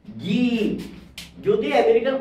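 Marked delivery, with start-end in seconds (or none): 0.0-0.4 "জি" said as American zee. Shown as a demonstration. Teacher demos American "জি" for Z. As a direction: say "জি" as a long zee. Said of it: "American pronunciation তাহলে এর উচ্চারণ হবে".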